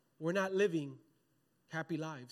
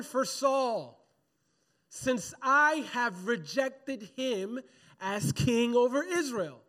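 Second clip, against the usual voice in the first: loud, slow, drawn-out preacher's reading; big, measured pulpit declamation; scripture proclamation; 4 semitones higher, 5 dB louder than usual. Acted. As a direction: proclaim God's words to the congregation, raised pitch. Reads scripture aloud to congregation.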